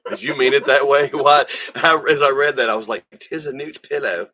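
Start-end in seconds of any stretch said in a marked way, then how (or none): 3.2-4.3 "'Tis a nude pillow" in a lightly put-on English accent; a hint of a mock English accent, "'Tis" said as a toff would. Reading the line back to a friend in a mild mock English accent. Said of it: "Why did I hear that in English accent?".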